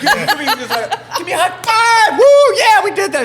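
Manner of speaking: high, loud, laughing whoops; giddy lawyer. Retold or imitated. Lawyer demanding a high five, giddy high whoop. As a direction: crow with glee, laughing through it. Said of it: "this guy's a nut job".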